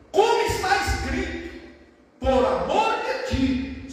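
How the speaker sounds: loud, high opening, drawn out at the end; declaimed scripture. Acted. Preacher quoting what is written, loud scripture declamation. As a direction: proclaim the verse like a preacher.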